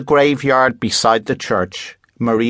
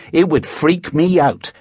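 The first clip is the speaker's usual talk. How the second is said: gasped; 6 semitones higher than usual. A narrator's reading. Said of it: "Helen gasped".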